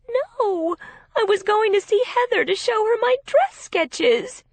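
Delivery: high, tearful denial; upset protest. A narrator's reading. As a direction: protest through tears. Distressed sister protesting.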